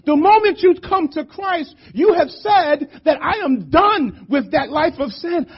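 high, urgent preaching voice; impassioned preaching. Real, not acted. Preacher urging stronger gospel preaching to church, impassioned preaching.

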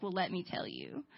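low, soft reply; understated lead-in. Retold or imitated. Ray answering Gabe, acted low and softly. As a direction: begin low and quiet. Ray's first take, low.

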